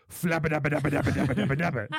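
mock fart sputter on repeated syllables; imitated fart. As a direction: imitate a long, fluttering fart. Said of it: "really loud".

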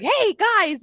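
high cartoonish greeting; teacher greeting kids. TV teacher talking to the kids, high cartoonish greeting. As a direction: call out high to a class. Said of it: "I heard her talking to the kids".

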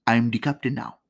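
imitated Somali accent, short separate phrases; movie pirate captain with Somali accent. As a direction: take command in a mock Somali accent. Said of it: "like, the Somali pirate type accent".

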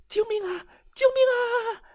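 very high, loud meowing cries; frantic cat shouting help; cat crying for help. Acted. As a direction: wail for help like a scared kitten. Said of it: "猫喵喵地叫道".

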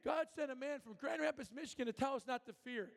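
high, excited call to the room; retold yell. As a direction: shout it out to everyone. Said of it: "He yells out in front".

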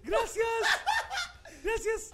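high-pitched mock voice; mimicking caller's voice. Host mocking a voice message, high put-on voice. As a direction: pitch it way up, teasing. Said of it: "¿Pero por qué habla así?".

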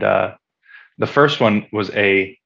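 normal talking voice

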